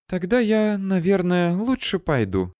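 высокий обиженный голос; гордо уходя, с обидой; обиженная эльфийка. A narrator's reading. Offended elf leaving. (обиженно, высоко)

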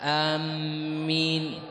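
آمين with a short alif and a doubled m; doubled m amen. Shown as a demonstration. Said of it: "هذه اللغة الثالثة؟". (آمين بتشديد الميم)

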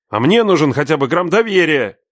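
outcry. A narrator's reading. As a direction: throw it back at father. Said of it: "выкрикнул я".

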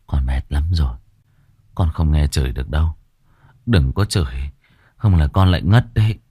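low, weak, faking faintness; feigned exhaustion. Acted. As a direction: play the limp sick boy. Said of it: "như thể không còn sức lực".

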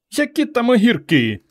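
very high, exasperated wail; high, distressed dismissal; mother lamenting. A narrator's reading. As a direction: bemoan it in a high voice. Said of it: "бідкалася мати".

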